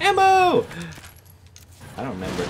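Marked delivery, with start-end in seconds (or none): 0.0-0.7 sudden high, loud cry of delight; excited yelp. Real, not acted. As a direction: yelp it out like you just found treasure. Spotting ammo in the game.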